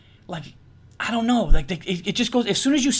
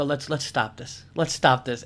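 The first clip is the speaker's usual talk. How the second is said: tender plea; 7 semitones lower, 6 dB quieter, slower than usual. Retold or imitated. Soft hug plea to girlfriend.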